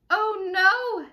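ordinary delivery

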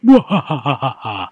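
slow, high, loud villain cackle; evil maniacal laugh. Pretend. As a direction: cackle like a mad genius villain. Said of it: "evil, maniacal".

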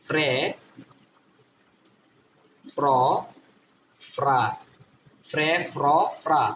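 ফ্রে, ফ্র, ফ্রা with F and R blended; phonics drill. Shown as a demonstration. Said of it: "এটা উচ্চারণ কি হবে?".

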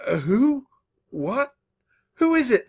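high-pitched, startled stammer. A narrator's reading. A sleepy mouse answers a shrieking caller, stammering. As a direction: stumble out startled questions in a high voice. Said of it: "I stammered".

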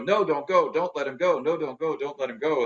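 pleading mother. Retold or imitated. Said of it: "and the mother is saying".

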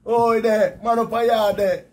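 loud, high call across the yard; shout for help. Retold or imitated. Lost man shouting to someone up a yard, loud high call. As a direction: yell out to be heard from far off. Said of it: "All me a shout and a shout".

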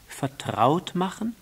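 high, rising echo; little prince questioning in a lifted voice; puzzled question. Acted. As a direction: repeat it as a wondering question. Prince questions the fox's word.

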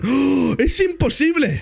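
high, drawn-out mock disbelief; gasp, then stretch it out high in feigned shock; escándalo fingido. Mock shock at an easy task. (incredulidad exagerada y aguda)

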